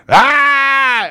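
high, loud scaring-off shout. Shown as a demonstration. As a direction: yell like you're scaring off a bear. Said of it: "you need to yell".

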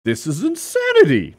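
a playful hint of a Russian accent; lightly imitating a Russian wrestler's accent. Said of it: "That's my Russian accent".

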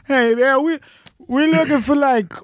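high, slow stoned asking; mildly stoned confusion; high stoner. Retold or imitated. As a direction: drawl it like you're high. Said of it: "trying to explain to this dude".

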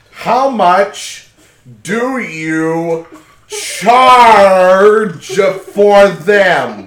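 slow and deliberate for a deaf ear; drawn out slowly. Acted. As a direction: stretch every word. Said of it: "Barge into what?".

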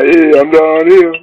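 slow, loud call back. Retold or imitated. The speaker hollers back to Kingfish, slow and loud. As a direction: shout your spot from far off.

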